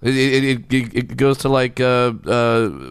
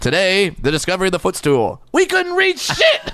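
raised, excited show-host voice, breaking into a laugh; sketch host; 7 semitones higher than usual. Pretend. Host of Moments in Dwarf History, high and excited. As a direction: hype it up like a proud history host. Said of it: "And then it's just like".

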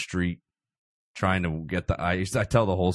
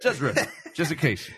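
laughing; 9 semitones higher than usual. Real, not acted. Repeating catchphrase, laughing.

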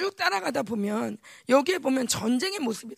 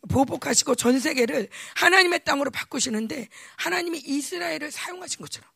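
ordinary talk

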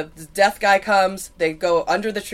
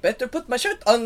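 higher, drawled mock drifter voice; drifter impression; 4 semitones higher than usual. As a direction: put on a goofy shirtless stranger. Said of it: "he was like".

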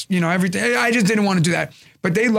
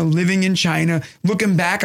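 usual speaking voice

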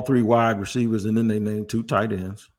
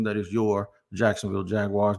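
ordinary talk

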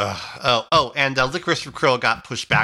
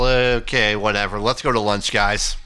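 offhand shrug; 6 semitones lower than usual. Acted. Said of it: "you can't help but think they're going".

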